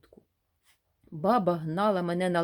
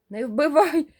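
high, loud panicked pleading; terrified plea to a devil; frightened babbling; 8 semitones higher, 9 dB louder, slower than usual. Acted. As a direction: beg for your life in terror. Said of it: "пролепетав я".

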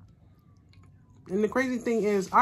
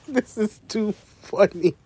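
slow, louder, laughing through it; 2 semitones higher, 5 dB louder, slower than usual. Real, not acted. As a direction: laugh while you say it. Laughing at shaking forks.

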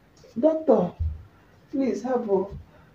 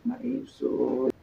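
pained groan; 11 semitones lower, 8 dB quieter, slower than usual. Acted. Labour pain groan.

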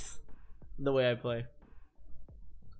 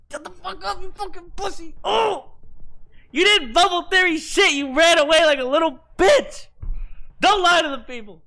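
high-pitched mock rage, then louder; angry rant at rival; 11 semitones higher, 4 dB louder than usual. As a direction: yell at the runaway. Ranting at fleeing opponent.

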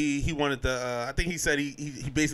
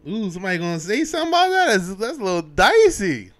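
mock wary reaction; 4 semitones higher, 5 dB louder than usual. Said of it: "I was just sitting over here like".

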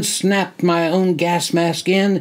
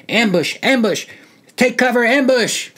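higher, urgent battle cry; shouting; 7 semitones higher than usual. Acted. Soldier shouting to his squad in the dark, urgent yell. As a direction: call the ambush out to the squad. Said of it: "I shout as loud as I can".

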